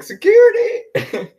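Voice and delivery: high, loud, drawn-out call; mock alarmed shout for a guard; calling security. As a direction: holler slowly for help.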